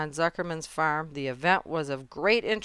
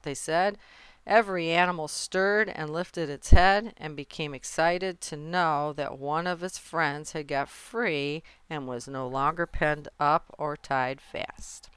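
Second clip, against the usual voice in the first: ordinary delivery, nothing marked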